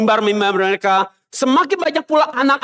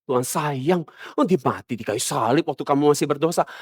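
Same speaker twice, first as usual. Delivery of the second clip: much softer and lower, cooing comfort; mock reassuring pastor; 8 semitones lower, 6 dB quieter than usual. False pastor reassuring a punished sinner, soft mock comfort. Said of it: "Mereka bilang".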